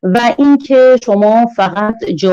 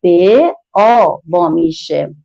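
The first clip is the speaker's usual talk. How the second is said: slow blending demo; much slower than usual. Shown as a demonstration. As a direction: stretch به and آ so the children hear them blend.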